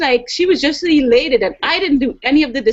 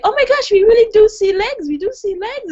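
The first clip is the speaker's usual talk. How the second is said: high, delighted disbelief; amazed; 11 semitones higher than usual. Retold or imitated. Family at a rest stop seeing the legs, high amazed. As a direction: marvel at it, higher.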